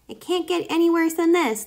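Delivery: high, small guinea pig voice; worried guinea pig. A narrator's reading. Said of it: "thought Kai".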